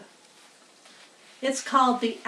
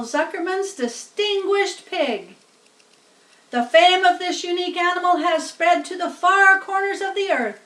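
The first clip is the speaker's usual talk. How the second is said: somewhat higher, louder PA speech; lightly pompous announcer; 7 semitones higher, 6 dB louder than usual. Acted. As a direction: proclaim it to the crowd. Loudspeaker announcement continues.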